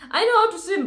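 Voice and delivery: high, loud, laughing protest; amused protest. Real, not acted. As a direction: say it through a burst of laughter. Laughing at own swimming remark.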